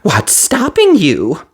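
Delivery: high, slow, insistent fairy; demanding fairy. A narrator's reading. Fiery fairy demanding what stops Cinderella, insistent. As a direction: press her for an answer. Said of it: "demanded the fiery fairy".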